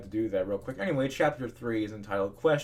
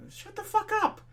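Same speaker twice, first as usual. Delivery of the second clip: exasperated rant. Real, not acted. Ranting at commenters.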